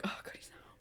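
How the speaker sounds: hushed whisper of relief; relieved whisper. Retold or imitated. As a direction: whisper it so nobody hears. Relief he is absent.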